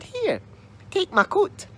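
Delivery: put-on high, bright girlish voice; chirpy young girl. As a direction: brush it off cheerfully.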